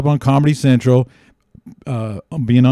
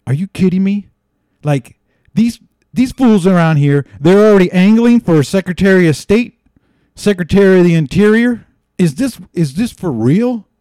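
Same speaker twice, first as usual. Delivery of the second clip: higher, incredulous outburst; pitched-up mock exasperation; worked-up retelling; 6 semitones higher, 4 dB louder than usual. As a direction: retell your own indignant disbelief. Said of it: "I was really affected".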